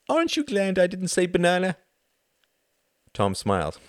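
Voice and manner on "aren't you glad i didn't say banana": put-on high, loud child voice; gleeful little girl. A narrator's reading. Lillian triumphantly finishing her joke, high child voice. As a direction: tease like a small girl winning.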